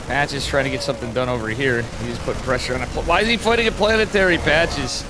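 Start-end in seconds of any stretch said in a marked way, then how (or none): 2.9-5.0 exasperated, higher-pitched disbelief; incredulous. Real, not acted. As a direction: commentator baffled by a blunder. Baffled by a player's mistakes.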